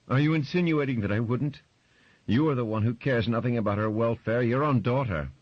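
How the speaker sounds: high, loud, bitter counter-attack; angry accusation. Acted. Wife accusing husband of not caring for daughter, high and loud. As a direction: turn the blame back on him.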